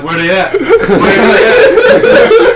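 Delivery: laughing high squeal of where they at; cracking up into a squeaky mock question; mock searching. Hunter mocking lost soldiers, high and laughing.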